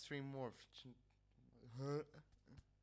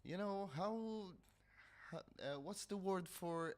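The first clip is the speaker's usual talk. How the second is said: searching for a word; 9 semitones higher, 5 dB louder than usual. Retold or imitated.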